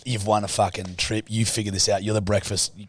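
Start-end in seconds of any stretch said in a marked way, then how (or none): none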